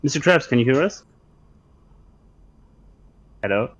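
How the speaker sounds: ordinary talk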